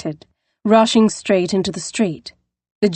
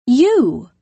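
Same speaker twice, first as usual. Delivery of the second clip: surprised; 6 semitones higher, 3 dB louder than usual. Acted. Recognizes her rescuer.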